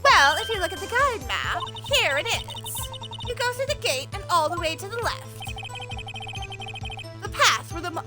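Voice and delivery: a lightly voiced bossy old lady. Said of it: "I'm doing my old lady voice".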